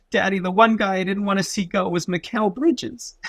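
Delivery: a hint of a teary little boy; imitating his son. Dad retells his son's words about the trade, lightly child-voiced. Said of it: "And he goes".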